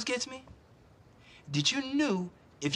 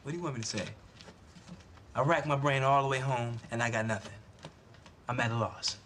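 defeated father; 9 semitones lower, 5 dB quieter than usual. Acted. Disappointed father confronts daughter.

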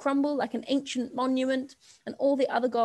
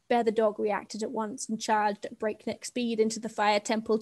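ordinary delivery, nothing marked

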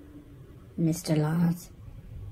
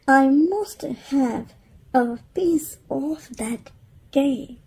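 higher, eager longing; craving mum; 7 semitones higher than usual. Acted. Mother alone at night, longing for cake. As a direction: sigh for the cake.

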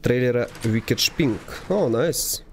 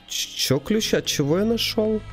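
puzzled high-pitched question; surprised high; 10 semitones higher than usual. Real, not acted. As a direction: sound baffled by the key. Puzzled at found key.

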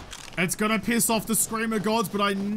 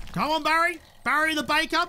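chirpy call to the baker; cheery calling out; 6 semitones higher than usual. Real, not acted. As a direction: hail your new buddy with delight. Calling companion, naming him.

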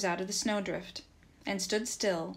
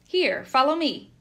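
high, loud shouted order; raised voice, urging him to follow; shouting; 6 semitones higher, 10 dB louder than usual. A narrator's reading. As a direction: shout for someone to come after you. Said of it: "Nikita shouted".

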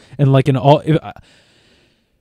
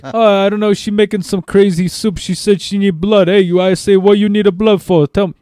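hammed-up Jersey accent, high and loud; Dino Bosco; 7 semitones higher, 3 dB louder than usual. Caller blaming a crazy soup to the deli, hammed-up Jersey accent. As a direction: put on a pushy, high Joyzy voice. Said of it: "I'm doing my Dino Bosco there".

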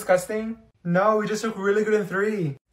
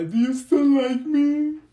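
mock tearful plea; 6 semitones higher than usual. Mock heartbroken plea to teacher.

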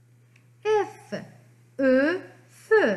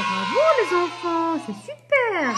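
higher-pitched, cheering teacher's bravo; excited praise; 4 semitones higher than usual. Pretend. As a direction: cheer the children on for getting it. Teacher cheers children's right answer. (félicitations enthousiastes, voix plus aiguë)

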